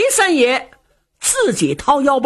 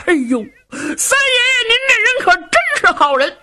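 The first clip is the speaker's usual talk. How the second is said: much higher, fast, sobbing praise; a fake blubber pitched way up; hammed-up wailing; 13 semitones higher, faster than usual. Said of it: "把裴元庆气坏了".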